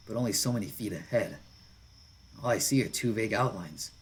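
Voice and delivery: ordinary delivery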